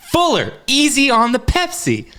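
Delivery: high-pitched movie-line impression; mock film scolding. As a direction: call out a high, mock parental warning.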